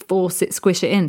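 ordinary talk

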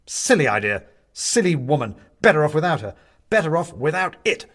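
loud, clipped angry exclamations; angry outburst. A narrator's reading. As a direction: spit out each short line in anger. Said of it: "Benedict is angry all over again".

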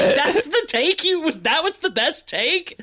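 high, laughing incredulous question; mock disbelief. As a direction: ham up the disbelief.

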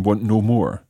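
usual speaking voice

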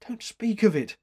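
high-pitched shuddering plea. A narrator's reading. Rescued woman recoiling from the attack, shuddering. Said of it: "she shuddered".

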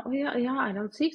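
a touch of a sweet kindergarten teacher; kindergarten staff voice. Retold or imitated. Mother imitating the kindergarten staff on the phone, mild concern. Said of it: "de bare".

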